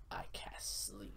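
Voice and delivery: low, slow eerie whisper; creepy voice. Retold or imitated. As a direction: breathe it like a spirit. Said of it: "like a creepy voice goes".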